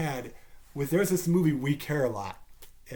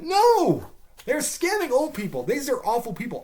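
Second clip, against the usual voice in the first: indignant yell; 9 semitones higher than usual. Real, not acted. Ranting about the movie, indignant yell. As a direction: shout it down.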